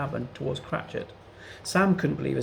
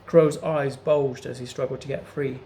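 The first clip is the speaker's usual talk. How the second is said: ordinary delivery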